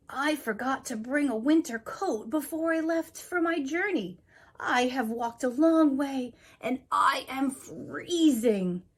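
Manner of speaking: lightly voiced shivering traveler; freezing traveler.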